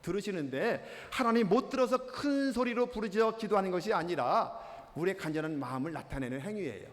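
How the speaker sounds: usual speaking voice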